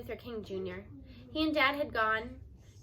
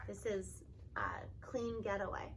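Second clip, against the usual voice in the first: whispered aside; 5 semitones lower, 9 dB quieter than usual. Real, not acted. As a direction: drop to a whisper between pages. Mom answers child mid-reading.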